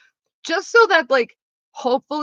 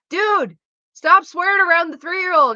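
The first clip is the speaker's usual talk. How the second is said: much higher, louder exasperated telling-off; exasperated scolding; 12 semitones higher, 8 dB louder than usual. Retold or imitated. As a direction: scold him, fed up at last. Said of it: "I'm like".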